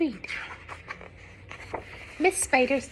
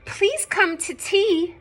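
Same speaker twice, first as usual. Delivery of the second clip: higher calling invitation; cried out; 8 semitones higher, 6 dB louder, slower than usual. Acted. Miss Spider calls out to the bees, pitched up. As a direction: call out to the bees hopefully. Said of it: "Miss Spider cried".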